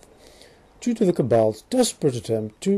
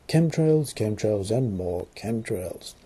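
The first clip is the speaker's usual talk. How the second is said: fading deadpan; 3 semitones lower, slower than usual. Acted. Deadpan weather report punchline.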